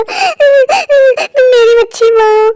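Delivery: tearful pleading, voice breaking into sobs; a son sobbing over his sick mother; crying. Acted. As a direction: cry it out to his unconscious mother, pitch climbing.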